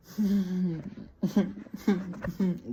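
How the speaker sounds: high, exaggerated fake sobs; mock sobbing. Girl crying to her best friend, mock sobbing. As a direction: imitate crying in high stuttering whimpers. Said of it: "she's like".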